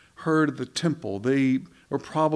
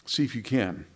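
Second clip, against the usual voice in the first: ordinary talk, nothing marked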